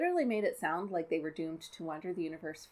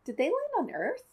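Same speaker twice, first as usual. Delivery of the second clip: surprised question; 11 semitones higher, 5 dB louder than usual. Real, not acted. Sudden realization.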